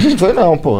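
laughing as you answer back. Real, not acted. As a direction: talk through the tail of a laugh. Rindo da resposta do amigo. (falando rindo)

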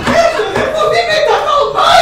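high, loud laughing protest; laughing shout. Acted.

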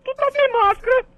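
high squeaky scolding voice; shrill guard scolding. Acted. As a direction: squeal at the guy without a mask. Guard scolds visitor, falsetto. (vigia fininho dando bronca)

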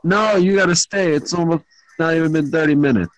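usual speaking voice

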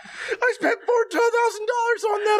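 hammed-up despair; overacted sobbing mother; mock wailing. Mom wailing about cost.

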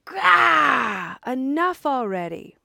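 loud, high exasperated shout; fed-up outburst. Acted. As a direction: burst out as if pushed past endurance. Buddha finally snaps at Mara.

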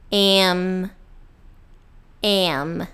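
"M" as em, closing into a hum; closed-lip M. Shown as a demonstration. Teacher drilling English M, closed lips at the end. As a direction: start "M" on eh, then seal the lips. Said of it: "a gente fecha os lábios".

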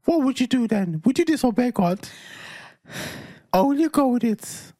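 joking high pitch, pressing the question; put-on high voice. Man testing if partner would still accept him, high voice. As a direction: keep the fake high voice going. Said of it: "this is my real voice".

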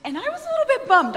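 a sheepish laugh at herself. Real, not acted. Laughing as she tells the women she was bummed.

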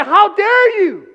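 slow, loud, high challenge; defiant champion. Pretend. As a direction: stand up and defy them. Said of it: "rise up and say".

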